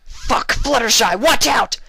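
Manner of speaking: very high, loud slow scream. A narrator's reading. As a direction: yell the warning with everything. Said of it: "Vinyl screamed".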